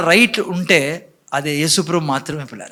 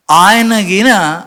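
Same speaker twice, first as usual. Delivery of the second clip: ordinary delivery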